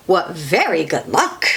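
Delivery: usual speaking voice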